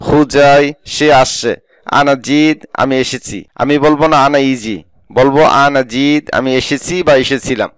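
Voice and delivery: ordinary delivery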